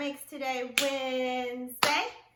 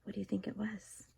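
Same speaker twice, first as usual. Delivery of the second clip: quick hushed whisper; 5 semitones lower, 7 dB quieter, faster than usual. Real, not acted. Teacher whispering a question to students.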